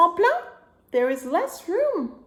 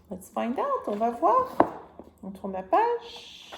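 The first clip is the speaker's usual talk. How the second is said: put-on reading to a toddler; 4 semitones higher, 3 dB louder than usual. Reading picture book to child.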